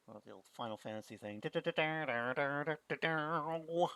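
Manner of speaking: normal talking voice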